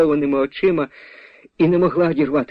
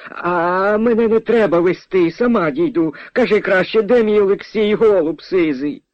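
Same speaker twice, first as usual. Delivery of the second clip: high, huffy peasant mother; testy mother; 8 semitones higher than usual. Acted. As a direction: demand your son impatiently. Mother snaps at the stranger.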